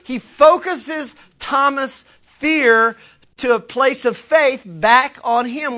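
normal talking voice

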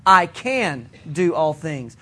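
"can" drawn out and stressed. Shown as a demonstration. Preacher showing youth emphasis drill, stress on "can". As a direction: lean hard on "can", slow delivery. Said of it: "A different emphasis would be".

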